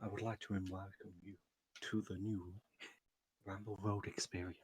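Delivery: low, soft radio announcer voice; smooth jazz announcer. Acted. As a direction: croon it like a late-night jazz DJ. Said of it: "smooth jazz announcer voice".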